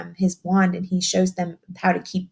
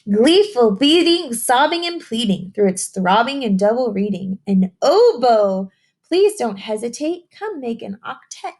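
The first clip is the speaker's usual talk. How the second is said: picture book reading; 4 semitones higher, 4 dB louder than usual. Acted. Teacher reading a rhyming book to young children, sing-song storytelling. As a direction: read the rhyme to the children, savouring each line.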